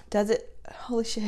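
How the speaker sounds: hushed, half-whispered shock; soft, awestruck murmur; stunned disbelief. Real, not acted. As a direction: barely breathe it out in amazement. Stunned as balance shows zero.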